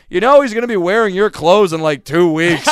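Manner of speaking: loud teasing call from across the room; dad yelling out. Retold or imitated. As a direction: shout the jab across the room. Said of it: "my dad from the back of the room yells out".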